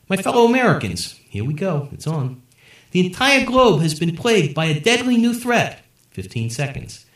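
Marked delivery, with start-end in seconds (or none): 0.1-1.1 presidential address. A narrator's reading. President begins speech. 2.9-5.8 presidential address. A narrator's reading. President speech on TV.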